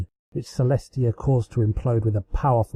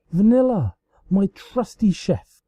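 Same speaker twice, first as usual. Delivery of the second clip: melodramatic grief; 8 semitones higher than usual. Acted. Celestia mourning her chef in mock grief. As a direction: wail it like a stage tragedy.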